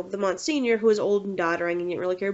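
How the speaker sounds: ordinary talk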